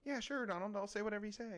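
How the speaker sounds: high-pitched mock yes-man; mock-compliant, high puppet voice; little puppet. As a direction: play an obedient puppet, pitched well up. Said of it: "Yeah, a little puppet".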